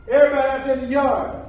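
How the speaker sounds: loud, driving preacher voice; preaching at full force. Acted. Preacher tells the congregation how he got the bike, loud. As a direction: preach it out, building the crowd.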